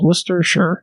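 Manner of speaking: Worcestershire said WUSS-ter-sure; SURE ending. Shown as a demonstration. Host showing a friend how it's said, SURE ending. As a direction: end Worcestershire on sure, not shire. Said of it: "they don't say shire, they say sure".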